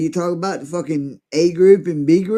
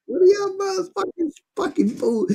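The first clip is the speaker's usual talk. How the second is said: mock high outburst.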